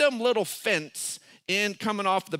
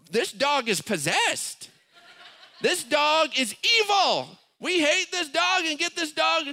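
put-on complaining kids; 8 semitones higher than usual. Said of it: "my kids are like".

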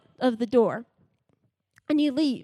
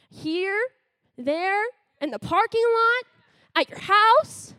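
building preach; 5 semitones higher, slower than usual. Acted. Speaker listing places God is with them, building. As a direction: pile up the places with growing force.

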